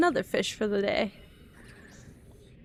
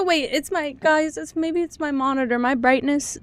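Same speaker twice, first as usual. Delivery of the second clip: usual speaking voice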